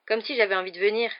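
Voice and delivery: cold retort. A narrator's reading. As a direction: brush him off coldly. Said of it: "répliqua froidement Harry".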